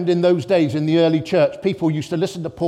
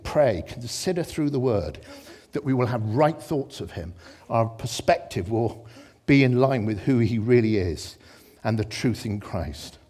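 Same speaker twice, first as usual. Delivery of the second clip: ordinary talk, nothing marked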